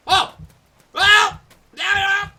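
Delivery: panicked high screaming; being chased. Real, not acted. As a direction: shriek in terror, fleeing. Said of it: "I'm being chased by a crocodile".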